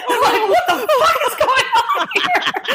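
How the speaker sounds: high laughing what-the-hell; laughing disbelief. Retold or imitated. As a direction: squeal it out in shocked amusement. Retold shock at singer's speech.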